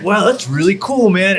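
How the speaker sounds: mock excited friend. [name] reacts to the news.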